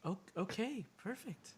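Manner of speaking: high, light waitress voice; serving girl. Acted. Server taking a drink order, in a high girlish voice. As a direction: play the waitress, pitched well up. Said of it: "Oh, she just goes".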